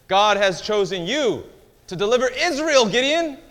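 high, booming heavenly proclamation; loud, high angelic summons; proclaiming angel. Acted. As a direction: announce a divine calling at full volume. Angel proclaims God's call to Gideon.

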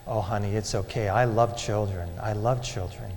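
low, soft voice of a kind lady; gently reassuring. Retold or imitated. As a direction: soothe a wary lost child, softly. Kind lady reassures lost child.

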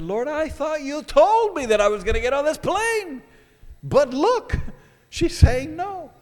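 protesting to the Lord, voice climbing; frustrated outburst at God. Retold or imitated. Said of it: "I took it out on the Lord".

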